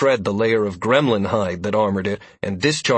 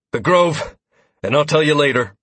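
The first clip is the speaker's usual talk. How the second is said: curt; 4 semitones higher, 4 dB louder than usual. A narrator's reading. Brushing off question in battle.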